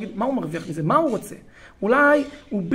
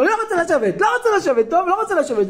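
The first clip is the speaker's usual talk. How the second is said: loud, high-pitched mock tantrum; defiant child; 10 semitones higher, 5 dB louder, faster than usual. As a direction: act a kid refusing in front of everyone. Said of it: "הוא אומר". (ילד מתעקש בקול רם וגבוה)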